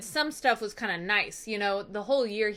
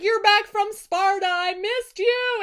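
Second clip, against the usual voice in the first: mock tearful welcome; 9 semitones higher, 4 dB louder than usual. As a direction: gush it high, pretending to cry. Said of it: "we see Eumaeus crying".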